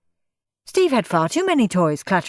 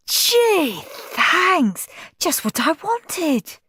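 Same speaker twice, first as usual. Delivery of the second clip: delighted; 11 semitones higher, much slower than usual. Acted. Henry delighted.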